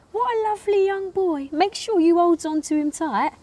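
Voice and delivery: high-pitched nan voice; doting nan. Retold or imitated. Nan praising granddaughter's boyfriend, doting. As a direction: put on an approving grandmother, pitched well up. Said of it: "My nan used to say".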